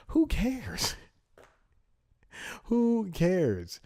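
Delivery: high-pitched, incredulous exasperation; exasperated outburst. Real, not acted. Podcaster ranting about articles on film cameos, exasperated. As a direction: throw it out high, utterly fed up.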